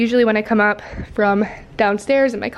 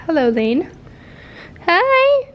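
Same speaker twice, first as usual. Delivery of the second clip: much higher, cooing hi; cute pet voice; 7 semitones higher, slower than usual. Real, not acted. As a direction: coo a tiny hello to the pet. Greeting her pet Lane.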